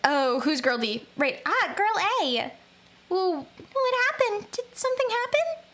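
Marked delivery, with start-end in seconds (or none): 3.1-5.6 high worried voice. Acted. Voicing worried girl character.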